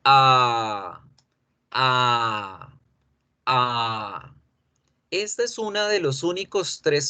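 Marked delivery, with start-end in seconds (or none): 0.0-4.4 "A" held twice as long. Shown as a demonstration. Teacher demonstrating the lengthened A sound. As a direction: stretch the "A" out like two vowels. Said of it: "alargar el sonido de la A".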